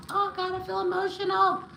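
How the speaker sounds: high, choked-up little wail; emotional, near tears. Real, not acted. Reader overcome by a client's message, high and emotional. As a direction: let the feeling push your voice right up.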